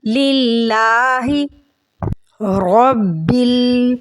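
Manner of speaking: slow, drawn-out tajweed chant; measured Quranic recitation. Acted. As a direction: recite it melodically, stretching the vowels.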